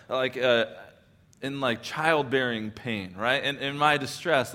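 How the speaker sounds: ordinary delivery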